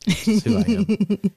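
dramatic declaration. Pretend.

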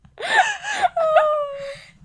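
a high, loud burst of laughter. Real, not acted. Laughing loudly at her own failed British accent.

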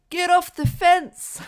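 put-on high, loud shout in his slipped accent; Sam Neill. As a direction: yell it slowly, mimicking his real accent. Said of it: "Sam Neill's accent came out".

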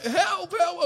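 hammed-up cry for help; mock call for help. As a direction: call out for help across the field. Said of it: "And I'm like".

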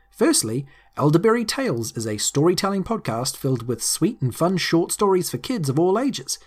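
normal talking voice

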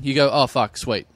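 usual speaking voice

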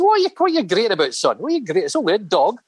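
high, fast, scoffing boss; gruff club owner. Retold or imitated. Scottish club owner scolding a bitten young Dave, fast scoff. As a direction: brush off the dog bite impatiently.

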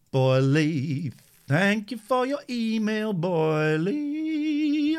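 lightly put-on high voice, slow; mock-grand thanks; playful sing-song. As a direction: tease a friend by name.

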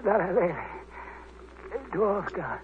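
weak dying voice. Acted. Dying man gasps out his story.

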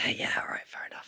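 soft whispered agreement. Real, not acted. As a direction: breathe it almost silently. Whispered reply.

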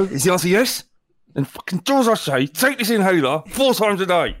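loud, bossy order; bossy official. Acted. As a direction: bark orders at him.

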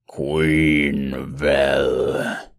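low, bubbling, gurgling growl; deep gurgling monster. Acted. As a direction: rumble it up from a boiling pool. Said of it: "said the monster in a deep, gurgling voice".

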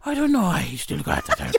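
low, gruff mock Northern Irish accent; Liam Neeson voice. Mocking the actor's lasting accent, Northern Irish imitation. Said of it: "he still got the accent".

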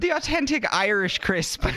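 high, exaggerated Irish accent; comic Irish lilt, pitched well up; Irish twang. Shown as a demonstration. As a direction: play up a bad Irish accent. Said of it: "practicing her own little Irish twang".

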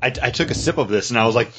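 ordinary talk, nothing marked

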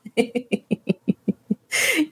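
staccato run of giggles; real laugh. Real, not acted. Host laughing at feeling alone now, real laugh. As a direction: crack up at your own singing. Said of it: "And I'm laughing because".

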